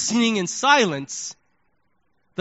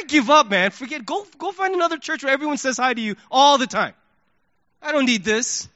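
higher-pitched exasperated giving up; fed-up human side; 7 semitones higher than usual. Retold or imitated. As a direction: act out throwing in the towel. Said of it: "the human side of me was like".

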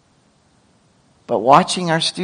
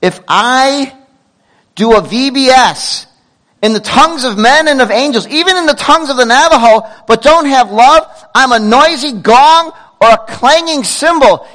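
Paul preaching; 8 semitones higher, 4 dB louder than usual. Retold or imitated. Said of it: "like Paul would say this".

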